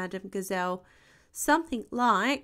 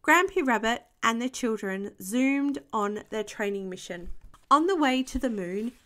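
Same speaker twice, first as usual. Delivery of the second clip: usual speaking voice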